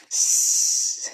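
loud, drawn-out snake-like hiss; long sharp hiss from a creature; Gollum's hiss. A narrator's reading. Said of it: "whispered and hissed".

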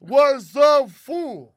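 big shouted show opener; loud greeting. Real, not acted. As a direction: call it out loud to the room.

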